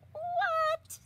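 shocked high yelp. Real, not acted. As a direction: blurt it in disbelief. Shocked reaction to Kitty's list.

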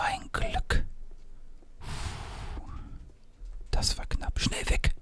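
tense whisper, hiding from her; whispering while hiding. Real, not acted. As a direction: whisper urgently so she can't hear. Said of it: "Psst, sie darf uns nicht sehen".

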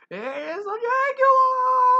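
very high, loud, slow panicked scream; terrified shriek. Acted. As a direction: shriek it in terror. Friends panic as monster enters.

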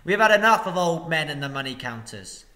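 loud, scornful shout; angry crowd shout. Acted. The people jeer at the master, loud and scornful. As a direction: jeer at the old men. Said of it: "shouted in reply".

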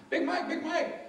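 high mock nagging call; pestering callers. People calling Big Mike over and over, pestering. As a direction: pipe the name up high, twice. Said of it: "been asked a hundred thousand times".

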